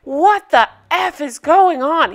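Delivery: imitating a furious boyfriend shouting down the phone; retold yelling. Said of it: "He is yelling".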